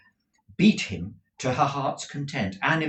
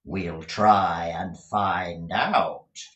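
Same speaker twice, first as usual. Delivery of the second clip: menacing schoolmaster; 4 semitones lower, 4 dB louder, much slower than usual. Acted. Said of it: "replied Squeers".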